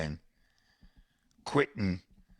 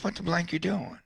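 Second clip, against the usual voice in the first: surprised; 9 semitones higher than usual. Retold or imitated. Friend catches him smoking.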